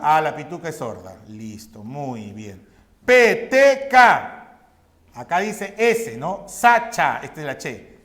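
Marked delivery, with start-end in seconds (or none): none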